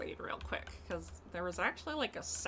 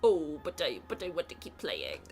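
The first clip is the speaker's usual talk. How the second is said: ordinary talk, nothing marked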